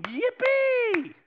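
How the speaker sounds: high, loud gleeful yippee; joyful God. Acted. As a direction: squeal it like a delighted father. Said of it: "God's going".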